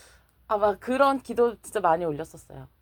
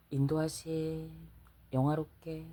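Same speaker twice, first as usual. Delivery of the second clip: received divine words; 4 semitones lower, 9 dB quieter than usual. Retold or imitated. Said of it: "처음엔 네 자".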